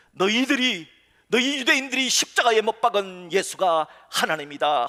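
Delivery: loud, bold preaching; bold proclamation. Retold or imitated. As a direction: proclaim it boldly to the crowd. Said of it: "담대하게".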